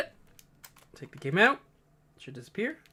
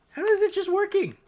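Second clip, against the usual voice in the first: surprised; 7 dB louder than usual. Real, not acted. Baffled at the device.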